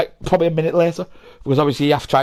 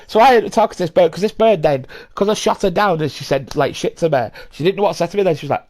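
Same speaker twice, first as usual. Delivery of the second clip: ordinary delivery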